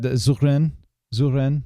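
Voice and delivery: "Zuren" tried twice, a Z start and a strained German R; host fumbling "Zuren" with an awkward German R; trying German name. Retold or imitated. Host attempting the guest's German name "Zuren", hesitant. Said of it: "the R is hard for me to do that".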